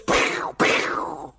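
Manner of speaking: unvoiced pow pow; breathy imitated gunshots; whispered gunshot noises. Mimicking gunshots in the story.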